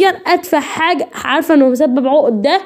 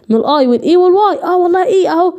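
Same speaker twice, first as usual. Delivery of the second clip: ordinary talk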